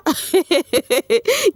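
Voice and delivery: high burst of laughter; giggling. Real, not acted. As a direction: let a giggle escape. Laughing after quote.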